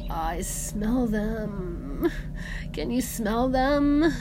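very slow, rising into a high wail; eerie trance. A narrator's reading. Medea senses her dead children, slow haunted wail. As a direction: drag each word out, haunted.

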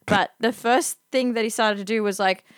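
usual speaking voice

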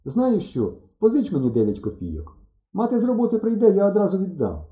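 high-pitched, eager plea for a favour; urgent request. A narrator's reading. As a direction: press her eagerly, pitch lifted. Urgently asking her for a loan. (схвильовано просить позичити)

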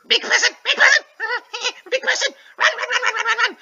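very high, loud squeaky rat voice; tiny terrified rat squealing; panicked rat voice. Acted. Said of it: "the rat just goes to run off and he's like".

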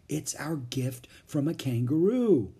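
ordinary delivery

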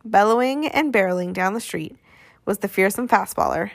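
usual speaking voice

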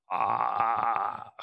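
low, hollow mock groan of a broken animatronic; mock robotic groan. Imitating a broken animatronic to a friend, low mock groan. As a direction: drone it out like a jaw stuck open. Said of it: "It was going".

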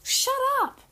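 high, loud snap; shouting. Real, not acted. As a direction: shout them down. Sister yelling during the recording.

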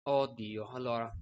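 ordinary talk

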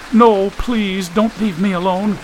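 put-on high-pitched, pleading girl's voice; frightened. A narrator's reading. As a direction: beg them fearfully to stay. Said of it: "Suddenly she was frightened".

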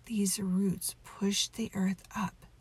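ordinary delivery

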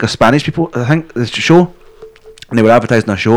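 ordinary talk

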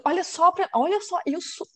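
high-pitched indignant disbelief; mock outrage. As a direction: retell being worked up, voice jumping high. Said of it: "eu fiquei muito pistola".

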